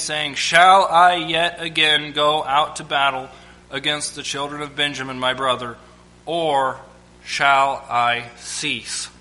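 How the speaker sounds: measured Bible reading voice; preacher reading scripture to the church. Acted. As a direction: read the passage with weight.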